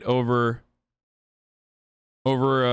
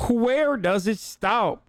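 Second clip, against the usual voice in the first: high, drawn-out mock politician voice; Ralph Norman; 6 semitones higher, much slower than usual. Streamer echoing the congressman's radio clip, slow high mocking imitation. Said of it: "exactly what he sounds like".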